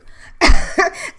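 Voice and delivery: breathy high cringe laugh; loud whispered giggle. Real, not acted. Host cringing at herself, breathy high giggle. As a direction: giggle out in an embarrassed breathy burst.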